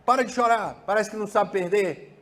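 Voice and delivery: strict father yelling at his son to stop crying; repressive father. Shown as a demonstration. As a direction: snap at the crying boy. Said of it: "outro tipo de pai que fala assim".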